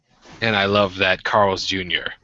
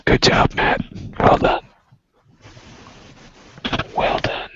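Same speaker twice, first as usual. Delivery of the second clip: stage-whispered congratulations; whispered praise. Pretend. Praising the host for breaking silence, whispered. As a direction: whisper approval from the sidelines.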